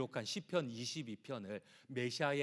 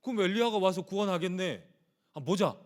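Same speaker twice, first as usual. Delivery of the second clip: raised, jeering voice of the mockers; mocking crowd, jeering and raised; mocking taunt; 5 semitones higher, 4 dB louder than usual. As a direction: sneer at him like the crowd below the cross. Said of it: "유대인들이 그러는 거예요".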